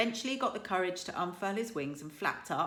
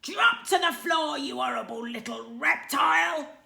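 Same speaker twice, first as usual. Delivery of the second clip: much higher, far louder roaring shout; furious yell; 8 semitones higher, 11 dB louder than usual. A narrator's reading. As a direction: blast it at the dragon. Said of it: "yelled Stoick".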